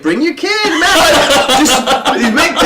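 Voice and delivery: high, hyped-up invitation; mock-enthusiastic urging; eager prankster. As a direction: egg them on, laughing. Prankster eagerly urges them.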